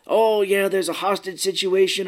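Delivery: lightly hammed-up TV reporter; idiot newsman. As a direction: announce it like a clueless anchor. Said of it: "he's a huge idiot in this movie".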